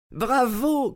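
bravo lancé très haut, ravi; crie bravo. A narrator's reading. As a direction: une exclamation de joie, très aiguë. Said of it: "cria notre hôte". (l'hôte découvre l'annonce et crie sa joie)

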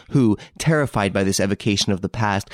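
ordinary delivery, nothing marked

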